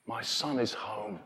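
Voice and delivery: soft slow my son is home; quiet loving father; tender father. Acted. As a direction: hush it with tenderness, slowly.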